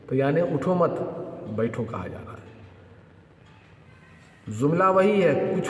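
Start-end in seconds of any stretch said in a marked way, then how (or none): 0.5-1.8 उठो मत together, break, then बैठो; pause after मत. Shown as a demonstration. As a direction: join उठो to मत and stop before saying बैठो. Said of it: "अंदाज बदल देंगे बोलने का". (उठो मत पर ठहरें, फिर बैठो)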